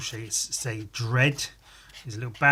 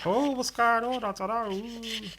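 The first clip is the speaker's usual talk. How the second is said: confused sock puppet; 9 semitones higher than usual. Acted.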